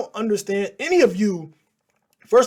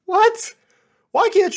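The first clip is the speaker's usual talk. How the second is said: incredulous; 13 semitones higher, 6 dB louder, slower than usual. Real, not acted. Mocking reaction to clip.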